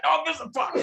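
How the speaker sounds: high-pitched interjection; playful high-voiced dismissal; mock tourist outburst. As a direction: blurt it high, cutting in on a friend. Imagined tourist butting in on friend.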